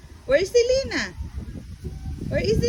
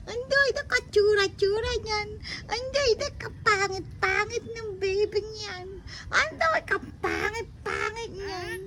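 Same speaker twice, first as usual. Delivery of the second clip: put-on baby talk; 3 semitones higher than usual. Mother teases baby, high voice.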